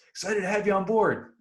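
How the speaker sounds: high, bright chirpy caption voice; mock coach caption. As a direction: read it like a cheesy social post. Mock caption for recruit photo.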